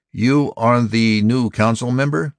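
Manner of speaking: high-pitched robot interrogation; incredulous robot. A narrator's reading. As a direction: voice a doubting robot, pitched well up. Robot questions the new human member.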